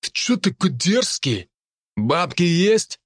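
high, slow bullying thug voice; russian street thug. Acted. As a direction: lean in like a menacing punk. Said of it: "набычился тот".

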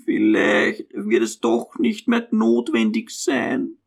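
put-on slow, high, hesitant old mother; high, faltering, tentative suggestion; somewhat timid. A narrator's reading. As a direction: venture it timidly and slowly. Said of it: "meinte sie etwas zaghaft".

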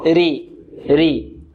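flat-tongued, unemphatic ري; light ra. Shown as a demonstration. Teacher contrasting ra with kasra for pupils, thinned ra. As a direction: say ري with a thin r before the i. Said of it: "وترقق إذا كانت".

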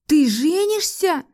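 high, loud, drawn-out demand; stunned outburst, pitched high and loud; blurted question. A narrator's reading. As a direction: burst out with a burning question. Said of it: "выпалила я жгущий душу вопрос".

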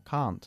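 "Can't" with the car vowel; British can't. Shown as a demonstration. Teacher corrects the student with the car sound. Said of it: "Car, pues lo mismo".

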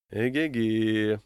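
long, drawn-out realisation; slow musing "ehe-he"; pondering exclamation. A narrator's reading. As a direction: stretch it out as a thought dawns. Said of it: "подумал я".

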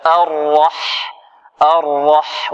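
الرح with a full, emphatic heavy R; correct heavy R. Shown as a demonstration. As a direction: say الرح with a thick, heavy ر, slow. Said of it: "ولا نقول الرح".

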